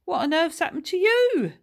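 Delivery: high, loud shocked cry. Acted. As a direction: blurt it out in disbelief. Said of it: "gasping for air".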